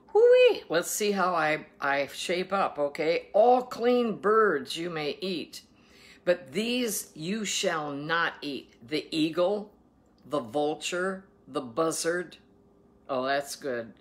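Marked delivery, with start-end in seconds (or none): 0.1-0.6 loud, high "hoo-wee"; high whoop. Real, not acted. Exclaims before composing herself. 3.3-12.4 slow, emphatic proclamation of scripture; scripture reading. Acted. Reads scripture aloud.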